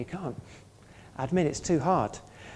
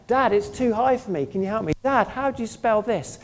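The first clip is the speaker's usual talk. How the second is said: put-on child asking dad; 7 semitones higher, 4 dB louder than usual. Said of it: "they're always expressing that dependence".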